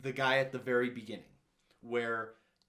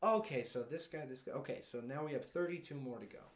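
matter-of-fact tallying; mock businesslike announcer; clinical imitation; 7 dB quieter than usual. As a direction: count them off with detachment. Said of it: "just so clinical".

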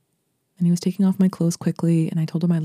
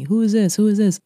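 higher, louder jealous demand; 5 semitones higher, 4 dB louder than usual. Retold or imitated. Jealous boyfriend demanding who called, angry. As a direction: interrogate her hard.

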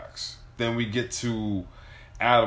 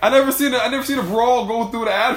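laughing; 9 semitones higher, 6 dB louder than usual. Real, not acted.

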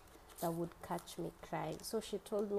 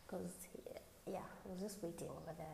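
whispered; 9 dB quieter than usual. Real, not acted. Hushed aside while waiting.

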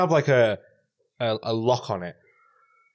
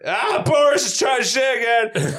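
higher, louder, slow shouting heckler; mock rant, high and loud at Boris; angry heckler; 13 semitones higher, 6 dB louder, slower than usual. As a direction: yell at the screen like a drunk bloke. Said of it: "Just like".

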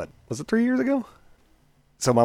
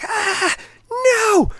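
high shrill-free mock scream, much higher and louder; retold screaming; 9 dB louder, much slower than usual. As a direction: scream it like a panicked woman. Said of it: "she's screaming".

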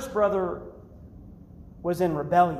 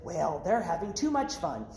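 disapproving Baptist; 2 semitones lower, 5 dB quieter than usual. Retold or imitated. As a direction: grumble like a stuffy churchgoer. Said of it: "he had to get all Baptist".

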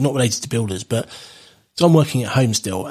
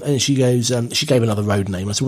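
ordinary delivery

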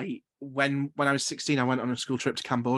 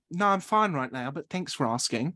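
put-on copied Australian accent. Passenger unconsciously copying the steward, imitated Australian accent. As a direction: answer politely in a borrowed Australian accent. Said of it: "I'd done it unconsciously".

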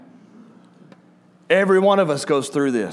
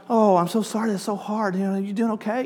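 higher, softer sympathetic tone; mock sympathy; 5 semitones higher, 4 dB quieter than usual. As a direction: coo the sympathy, a bit overdone. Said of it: "and I'm like".

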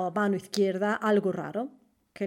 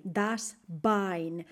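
ordinary talk, nothing marked

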